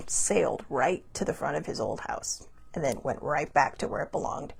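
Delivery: hushed ghost-story whisper. Acted. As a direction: breathe it out in a whisper.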